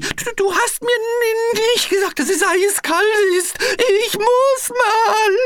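Piggledy freezing, blaming Frederik: chattering; teeth chattering. A narrator's reading. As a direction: chatter the Du at him. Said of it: "klapperte nun auch Piggledy".

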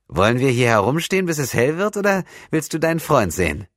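put-on high-pitched boyish voice, prodding; cheeky camp lad. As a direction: play an impatient youngster hurrying someone along. Voices the impatient lad, Rasson.

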